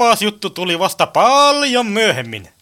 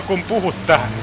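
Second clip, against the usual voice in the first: usual speaking voice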